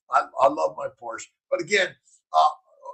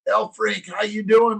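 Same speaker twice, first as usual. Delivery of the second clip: normal talking voice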